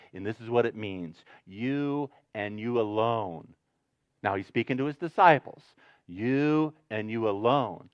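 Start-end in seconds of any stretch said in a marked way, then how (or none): 1.5-3.5 slow, drawn-out "You and you alone", low and soft; emphatic YOU. Shown as a demonstration. Preacher showing what the emphatic means, weight on "You" and "alone". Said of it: "he speaks it with the emphatic voice". 6.1-7.9 low, measured weight on "You" and "alone"; emphatic YOU. Shown as a demonstration. Jesus speaking to his disciples, low stress on "You" and "alone". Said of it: "he speaks it with the emphatic voice".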